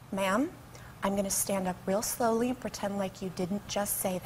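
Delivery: low, hushed, measured warning; stern stewardess. Retold or imitated. Stewardess discreetly warns passenger who said bomb, low and hushed. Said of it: "she goes like this".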